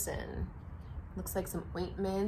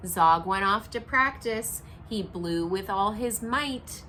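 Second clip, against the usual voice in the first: story narration; 5 dB louder, slower than usual. A narrator's reading. Reading picture book aloud.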